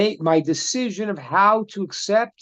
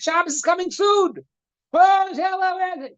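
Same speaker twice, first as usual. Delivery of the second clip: very high, shouted name; high mock yelling; 10 semitones higher than usual. Joker yelling for Hillel before Shabbos, high mock call. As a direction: call out impatiently.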